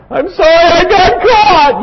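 put-on high, whining child's voice; whiny child. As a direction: whimper it like a sulky caught kid. Child's insincere apology mimicked.

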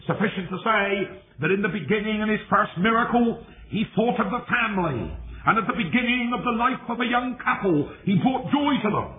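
high, ringing sermon delivery; impassioned preaching. Real, not acted. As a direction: preach it in a high, sustained declamation. Preaches at full force.

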